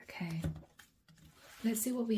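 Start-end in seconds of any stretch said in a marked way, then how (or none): 0.0-0.4 real quiet talk. Real, not acted. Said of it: "I'm not talking very loudly".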